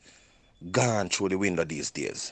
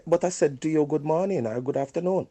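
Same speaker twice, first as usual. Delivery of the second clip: indignant greeting; 8 semitones higher than usual. Retold or imitated. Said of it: "And you said".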